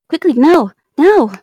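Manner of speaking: loud, urgent yell; urgent shout. Real, not acted. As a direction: shout to hurry them. Urging teammate to plant.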